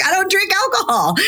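high-pitched incredulous retort; exasperated protest. Retold or imitated. Retelling her protest to drink offers.

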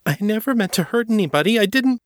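horrified and tumbling over her words. A narrator's reading.

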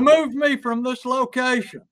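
high, mock-formal call for rescue; mock yelling. Trapped person demanding rescue formally, mock yelling. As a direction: call out a stiffly worded plea.

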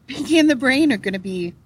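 hiccup mid-sentence; a sudden hiccup breaks the words. Real, not acted.

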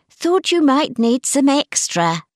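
a lightly voiced prim aunt; lightly voiced aunt reading her note; Auntie Mary's note. A narrator's reading.

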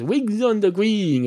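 high, slow repeat of the phrase; emphatic repeating. Acted. Cat repeating its odd expression to the barman, emphatically. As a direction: pitch it up and draw it out.

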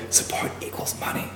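whispered aside, unvoiced and soft; whispering. Real, not acted. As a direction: lean in and whisper it. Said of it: "Sorry, I shouldn't whisper that".

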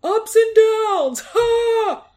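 loud obnoxious dad. Retold or imitated. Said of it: "loud, obnoxious".

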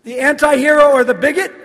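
high, loud echo question; mock incredulous. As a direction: throw it back in mock disbelief.

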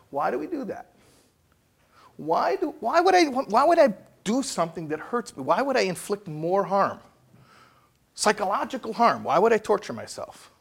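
high, puzzled voicing of the question; incredulous questioning. Speaker voices someone wondering why they hurt themselves, high and puzzled. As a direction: play the baffled self-tormentor.

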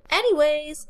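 loud, high interruption; a loud high-pitched anyways. A narrator's reading. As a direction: cut her off loudly. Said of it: "said Becca loudly".

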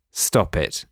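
low, firm government voice; deep firm voice, stern warning; serious voice. Pretend. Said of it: "this is my serious voice".

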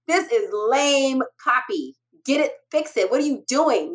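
mock stern telling-off; retold scolding. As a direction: imitate a mentor tearing work apart. Acts out mentor's harsh critique.

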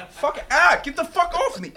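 high, loud panicked yelp; real jolt of alarm, pitch shooting up; startled yelling. Real, not acted. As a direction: yell at a bug to get off. Startled by spider on him.

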